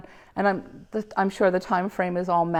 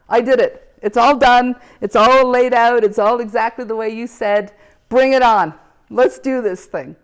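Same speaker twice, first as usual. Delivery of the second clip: loud, raised challenge, bring it on; defiant call to God; 3 semitones higher, 8 dB louder than usual. Retold or imitated. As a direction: dare the heavens at full voice. Calling out to God aloud.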